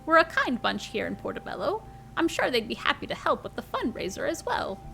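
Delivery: lilting grandmother voice, pitch swooping high; Granny. Acted. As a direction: play a fond, spirited granny fussing over her grandchild. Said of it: "It's Granny!".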